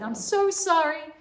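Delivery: loud, high apology; sorry students. Acted. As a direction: call out your sorry together. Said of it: "all the students said".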